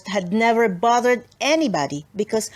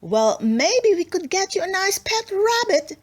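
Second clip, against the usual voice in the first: mom offering a pet; 8 semitones higher than usual. Acted. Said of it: "said her mom".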